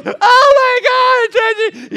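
high, loud, very slow wail; sobbing teen girl. Retold or imitated. Girl crushed by her pastor's outburst, sobbing loudly. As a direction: cry out like she's devastated. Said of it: "And she goes".

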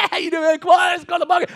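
high, loud cheering from the stands; cheering shout. Retold or imitated. Youth pastor cheering his teens at the game, shouting. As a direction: whoop the kids on like a fan.

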